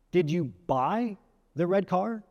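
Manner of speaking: slow, with "buy" stretched and rising; stress on BUY. Shown as a demonstration. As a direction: punch "buy", pause before "the red car". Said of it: "in several ways with several different meanings".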